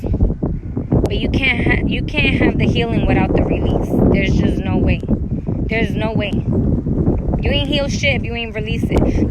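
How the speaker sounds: loud, driving preacher delivery; preaching at full force. Real, not acted. Telling viewers about healing, loud preaching. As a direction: drive it home like a sermon.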